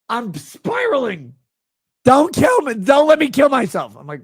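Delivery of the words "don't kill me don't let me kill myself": high, loud mocking whine; mocking the fan's plea, louder and high. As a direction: plead like a pathetic caricature. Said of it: "begging me".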